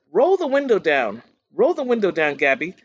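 high, loud retold call; urgent call. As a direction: call urgently to someone in a car. Retold man urgently calling to car.

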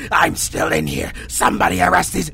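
loud cry from someone trapped inside; desperate outburst. Acted. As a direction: yell frantically for rescue. Swallowed victim cries out from inside.

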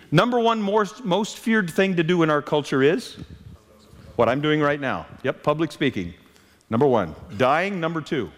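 ordinary talk, nothing marked

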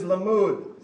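"lamud" said slowly, la-MOOD; teacher slowly voicing "lamud"; Hebrew root word. Shown as a demonstration. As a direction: model "lamud" for repetition. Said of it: "Say lamud".

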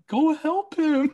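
shrill hammed-up plea; mock shriek. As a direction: squeal it like a panicked onlooker.

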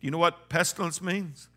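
soft, low, intimate sermon voice over music; hushed preaching. Acted. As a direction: bring it down and speak close to the heart.

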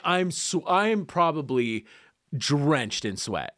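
ordinary delivery

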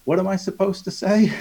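sales guy's question, rising high into a laugh; incredulous trainee voice breaking into laughter; laughing mock question. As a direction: play the baffled trainee, cracking up. Mocking the confused sales guy.

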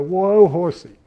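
a loud, playful "whoa" to rein someone in; mock call to halt. Barrister reining in a rambling expert witness, playful loud call. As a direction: call out like stopping a runaway horse.

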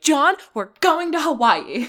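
high, loud, slow proclamation; hammed-up fantasy declaration to a husband; theatrical announcement. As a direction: announce it with grand drama. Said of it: "she's going for theatrics in her fantasy".

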